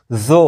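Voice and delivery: ज़ो with a thick, heavy Arabic consonant; weight the start of ज़ो, emphatic and rounded; isolated ज़ो, heavy emphatic sound. Shown as a demonstration. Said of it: "ये भारी आवाज़ है".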